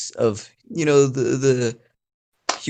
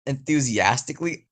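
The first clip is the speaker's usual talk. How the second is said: ordinary talk, nothing marked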